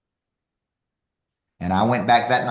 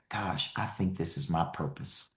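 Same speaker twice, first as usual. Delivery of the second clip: quiet musing; 4 semitones lower, 10 dB quieter than usual. Retold or imitated. Inner realization, quoted to self.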